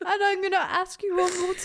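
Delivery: high weepy retelling of the speech; tearful quote. Retold or imitated. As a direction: quote it while tearing up. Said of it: "I've not got waterproof mascara on".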